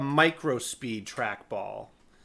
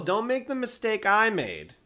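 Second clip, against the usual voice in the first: ordinary talk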